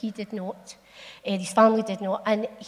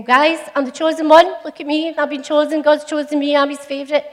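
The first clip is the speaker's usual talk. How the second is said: higher and louder, a bragging know-it-all; mock show-off; 5 semitones higher, 7 dB louder than usual. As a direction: play a smug show-off for laughs. Mock boastful Gideon imagined.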